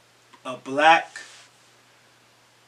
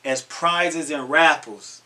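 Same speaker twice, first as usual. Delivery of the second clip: ordinary talk, nothing marked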